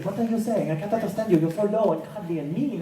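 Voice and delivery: high, bewildered character voice; high-pitched mock distress; France. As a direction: complain in a put-on high voice. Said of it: "To which friends will be like".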